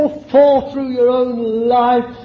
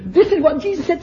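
ecstatic joy; 7 semitones higher, 6 dB louder than usual. Real, not acted. Overcome with joy preaching.

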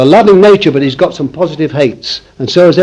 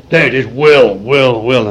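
mocking Calvinists; 3 semitones lower than usual. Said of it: "They say".

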